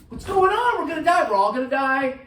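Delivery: high, loud, panicky "We're gonna die"; panicked cry. Retold or imitated. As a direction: cry out in mock panic in the sinking boat. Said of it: "they were going".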